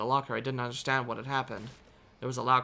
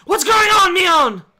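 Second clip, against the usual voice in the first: much higher, very loud demand; shouted demand; 19 dB louder, much slower than usual. Shown as a demonstration. Brother shouting at Mion, corrected take. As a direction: shout it at her. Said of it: "not the right delivery".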